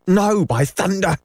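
very high, emphatic refusal; high-pitched defiant cry; cried out. A narrator's reading. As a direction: cry out in protest, pitch leaping up. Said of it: "Starkey cried".

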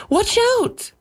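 very high-pitched scream of alarm; screamed warning. A narrator's reading. Wife warning her husband of charging lions, screaming. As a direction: cry out a warning, pitch shooting right up. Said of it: "screamed Lydia".

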